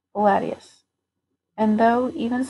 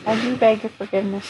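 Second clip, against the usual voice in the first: ordinary delivery, nothing marked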